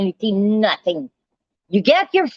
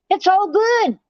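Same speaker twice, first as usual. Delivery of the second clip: 'It's all good' jumped much higher, mocking; sarcastic high repeat; 10 semitones higher than usual. Real, not acted. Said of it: "and it's all good".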